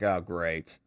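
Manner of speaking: low, soft, dismayed "oh, great"; mildly upset, said under the voice. Retold or imitated. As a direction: drop it low and quiet, let down. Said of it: "He was just upset".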